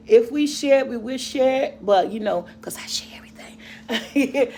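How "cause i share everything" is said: whispering under her breath; whispered aside. Real, not acted. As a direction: drop to a whisper for the joke. Joking aside about sharing.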